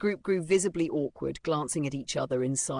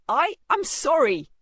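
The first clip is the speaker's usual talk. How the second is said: loud, high, slow panicked apology; stammering; 11 semitones higher, 9 dB louder than usual. A narrator's reading. Boy begs the bully's pardon, stammering loudly. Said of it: "could only stammer".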